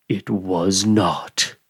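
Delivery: low, slow goblin's contradiction; deep voice. A narrator's reading. Unseen goblin contradicting the sexton, deep voice. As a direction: drop low and draw out each word. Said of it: "said a deep voice".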